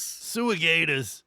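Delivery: slow, drawn-out mock Jersey Guido; Jersey Guido accent. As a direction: stretch it like a Jersey caricature.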